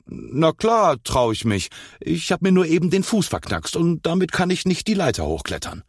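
high-pitched defensive bluster; insist, then excuse yourself in a high voice; boastful boy making excuses. A narrator's reading. Cornered boy figure makes excuse.

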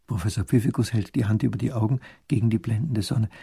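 ordinary delivery

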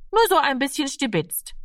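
lightly voiced small boy, pitched high; high, innocent little excuse; child playing it down. A narrator's reading. Boy plays down his theft.

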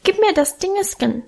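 put-on high, pleading toddler voice; high little child voice. A narrator's reading. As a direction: beg like a small boy. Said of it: "bat mit seinem hohen Stimmchen".

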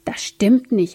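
ordinary delivery

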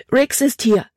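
high, urgent cry of alarm; a girl bursting in with bad news; urgent exclamation. A narrator's reading. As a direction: blurt it out breathlessly to her father. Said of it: "rief sie aus".

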